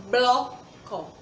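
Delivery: "bloco" split into blo-co; syllable by syllable. Shown as a demonstration. Teacher asks how many sounds she makes, saying "bloco" in two separate beats. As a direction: say "bloco" with a gap after blo. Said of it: "Eu abro a boca quantas vezes".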